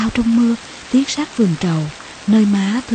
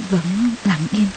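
plain reading voice

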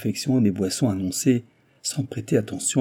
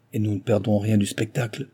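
plain reading voice

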